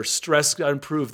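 ordinary delivery

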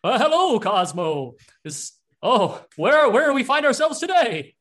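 loud, high-pitched booming greeting; big excited cartoon voice, swooping high; Steve. Waking companion greets Cosmo, loud high character voice. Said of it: "as Steve, uh, comes to life".

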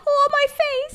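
very high squeaky mock whimper; mock whine. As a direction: squeal it like a wounded cartoon villain. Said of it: "And he's like".

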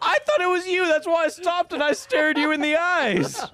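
high, incredulous protest through laughter; laughing accusation. Real, not acted. Telling co-host he suspected him, laughing high protest. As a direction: squeal it out while cracking up.

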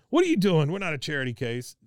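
loud, high mock indignation; imitating an affronted husband; indignant outburst. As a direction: burst in objecting, loud at first. Retelling proud husband bursting in, objecting.

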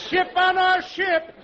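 high, loud, slow sing-song call; high comic ship call; mock lookout call. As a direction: holler it like a lookout.

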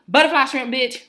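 loud, high, emphatic retort; irate correction. Retold or imitated. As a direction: fire it back loudly, fed up. Snapping correction back at worker.

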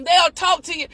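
ordinary delivery

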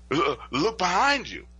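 gasping, halting mock fright; imitated panicked stammer. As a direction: stammer it out like a terrified wife. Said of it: "she's stuttering and stammering".

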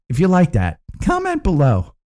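bright high YouTuber pitch; influencer voice. Acted. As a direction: sell it like a hyped influencer. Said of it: "my influencer voice there".

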